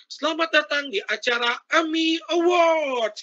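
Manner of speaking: a hint of a high showbiz host; lightly voiced gala host intro; mock MC. Shown as a demonstration. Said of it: "Jangan M C-nya udah". (pembawa acara yang dibuat-buat)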